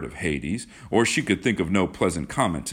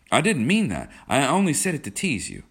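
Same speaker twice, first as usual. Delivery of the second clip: higher, earnest backpedalling; hasty protest with raised pitch; 9 semitones higher, 4 dB louder than usual. A narrator's reading. John takes back his teasing remark, protesting earnestly. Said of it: "John was impelled to protest".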